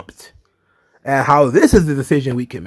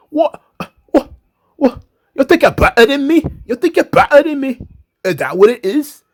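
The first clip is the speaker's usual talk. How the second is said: imitating Nikki; 9 semitones higher than usual. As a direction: squeal it out offended and accusing.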